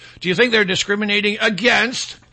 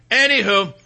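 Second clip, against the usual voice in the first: normal talking voice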